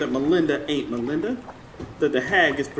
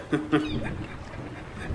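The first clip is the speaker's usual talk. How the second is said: breathy unvoiced laugh; 10 dB quieter than usual. Old man laughs about his dog.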